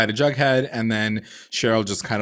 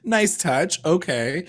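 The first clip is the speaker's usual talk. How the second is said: amused approval; 6 semitones higher, much slower than usual. Retold or imitated. Retelling own reaction to hair.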